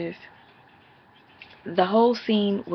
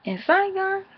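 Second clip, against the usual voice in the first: much higher, startled question; surprised double take; 13 semitones higher than usual. Retold or imitated. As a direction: sound like you just saw a twin. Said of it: "I was like".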